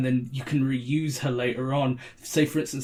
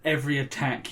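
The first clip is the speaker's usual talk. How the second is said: ordinary talk, nothing marked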